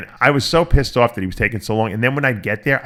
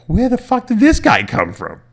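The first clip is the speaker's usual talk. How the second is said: high, slow, incredulous where did he come from; exasperated disbelief; 12 semitones higher, 4 dB louder than usual. Retold or imitated. Fighter griping about a new rival, high incredulous. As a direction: throw up your hands in disbelief.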